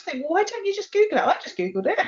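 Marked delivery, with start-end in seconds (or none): 0.2-1.2 high, quick incredulous question; baffled exasperation. Retold or imitated. Podcast host mimics her reaction to helpless people, exasperated. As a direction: ask it fast and high-pitched, as if it's obvious.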